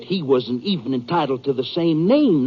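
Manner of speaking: ordinary delivery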